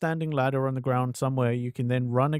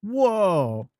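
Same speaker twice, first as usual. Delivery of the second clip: loud, high startled whoa; real amazement; surprised whoa; 7 semitones higher, 5 dB louder than usual. Real, not acted. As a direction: caught off guard mid-air. Game stunt launches him up.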